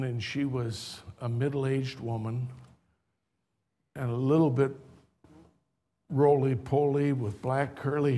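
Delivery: soft, low, tender telling with long pauses; hushed sermon storytelling. Acted. Preacher softly tells the congregation a moving memory. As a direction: draw the congregation in quietly.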